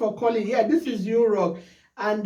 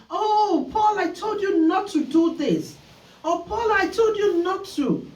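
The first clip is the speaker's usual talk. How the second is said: high, exasperated reproach repeated; a parent pitched up, telling off a child by name; mock scolding parent; 10 semitones higher than usual. Imitating a parent scolding little Paul, high and exasperated.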